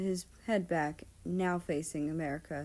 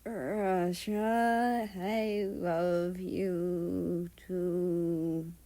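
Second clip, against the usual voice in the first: put-on slow, slurred drunken mumble; mumbled slurring; much slower than usual. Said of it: "America mumbled, still slurring his words".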